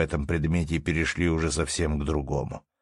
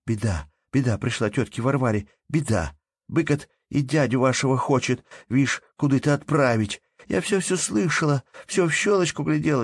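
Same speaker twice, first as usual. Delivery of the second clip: put-on higher, urgent and eager child's speech; with great animation; 9 semitones higher than usual. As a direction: rush the news out breathlessly. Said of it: "с необыкновенным одушевлением".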